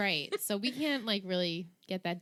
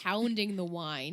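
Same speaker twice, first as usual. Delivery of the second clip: ordinary delivery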